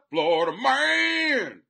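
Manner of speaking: loud, high, drawn-out holler; showy, stretched-out yell; hyped shout-out. Pretend. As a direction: holler it slow and big, stretching MAN. Shouts out fans from Florida.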